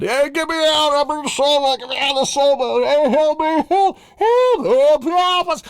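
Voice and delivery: high, frantic hammed-up yelling; mock cries for help. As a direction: scream to be freed from the boat. People trapped in sailboat.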